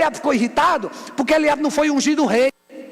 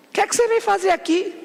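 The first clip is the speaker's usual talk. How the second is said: quick, high-pitched irritated questioning; high, testy acted challenge; irritated brother; 7 semitones higher, faster than usual. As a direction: demand it like an annoyed older brother. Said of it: "Aí ele ficou irritado".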